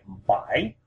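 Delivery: "bai" dipping, then rising clearly; carefully bend "bai" down and up; falling-rising tone. Shown as a demonstration. Teacher stressing accuracy on seven, "bai" down then up. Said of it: "get that really accurately, very clearly".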